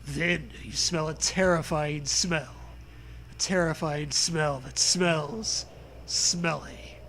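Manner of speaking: ordinary delivery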